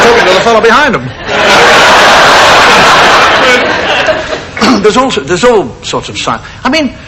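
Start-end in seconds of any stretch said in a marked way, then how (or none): 1.1-4.8 exaggerated throat clearing for a laugh; theatrical ahem; mock throat clearing. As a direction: clear the throat pointedly, hammed up.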